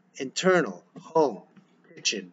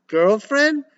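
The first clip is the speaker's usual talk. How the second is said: shocked; 13 semitones higher, 7 dB louder than usual. Acted. Mother shocked by news.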